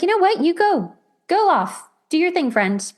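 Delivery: mock carefree send-off; breezy dismissal. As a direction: wave them off without caring. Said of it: "or you're avoidantly attached, you're just like".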